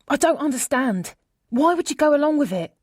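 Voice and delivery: high, agitated friend on the phone; upset friend. A narrator's reading. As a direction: pour it out in a high, hurt voice.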